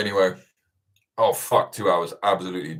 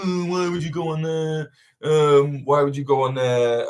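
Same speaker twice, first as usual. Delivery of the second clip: higher, sneering put-on snob voice; snobby mockery; 8 semitones higher, 4 dB louder than usual. Snobs mocking his podcast guest spot, in a sneering snooty voice. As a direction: mimic a sniffy snob looking down on it. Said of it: "like, being really snobby".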